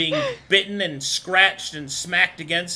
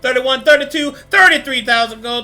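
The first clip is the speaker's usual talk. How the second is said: louder, higher auction calling; auctioneer; 5 semitones higher, 7 dB louder than usual. Acted. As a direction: rattle off the bids like an auctioneer. GM voices auctioneer calling bids.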